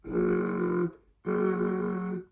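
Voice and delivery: gentle rattling hum; light growl hum. Shown as a demonstration. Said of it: "start nice and lightly".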